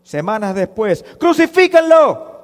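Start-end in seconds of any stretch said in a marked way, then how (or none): 1.2-2.3 very high, loud demand; mob's shout. Acted. Crowd turns on Jesus weeks later, shouted demand. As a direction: yell it like a crowd demanding execution.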